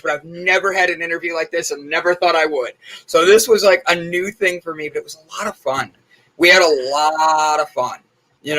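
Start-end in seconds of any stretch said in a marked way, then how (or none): none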